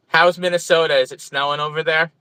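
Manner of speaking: usual speaking voice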